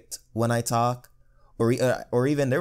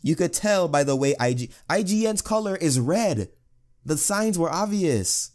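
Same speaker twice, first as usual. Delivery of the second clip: mock conspiracy voice; 8 semitones higher than usual. Finishing the IGN conspiracy bit with co-host, in a high mock voice. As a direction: reveal the obvious clue like a crackpot.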